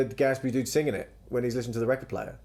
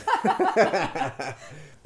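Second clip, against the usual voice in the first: sustained, higher-pitched laugh; real laughter; 9 semitones higher, 5 dB louder than usual. Real, not acted. Talking about the sauna reopening, real loud laughter. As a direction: burst out laughing loudly.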